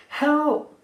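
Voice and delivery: a sharp, high, loud shout; yell for help. Retold or imitated. Trapped woman shouts up to the ladies above, high and loud. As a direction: cry out to be found.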